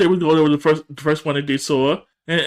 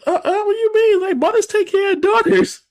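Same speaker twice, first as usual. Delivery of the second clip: high offended whine; mock outraged teen; indignant daughter. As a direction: play the wronged daughter, pitched way up. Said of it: "And Nikki's like".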